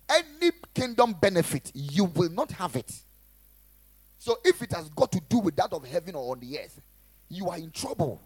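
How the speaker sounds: loud, driving sermon delivery; preaching. Acted. Pastor preaching loudly to his church. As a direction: preach it hard to the congregation.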